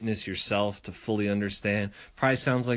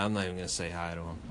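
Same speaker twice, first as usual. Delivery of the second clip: muttered aside; 6 semitones lower, 10 dB quieter, much faster than usual. Retold or imitated. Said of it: "I'm like".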